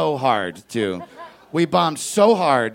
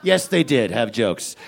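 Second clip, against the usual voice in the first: normal talking voice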